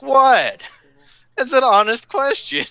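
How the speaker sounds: high-pitched mock protest; hammed-up wounded innocence, high; mock indignant. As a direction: play innocent, voice jumping way up. Retold defence of his joke.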